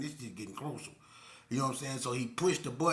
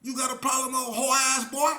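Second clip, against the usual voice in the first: high, loud sneering taunt; cocky yelled insult; confident taunt; 12 semitones higher, 9 dB louder than usual. Retold or imitated. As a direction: throw it back at him, confident. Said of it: "the way he said it".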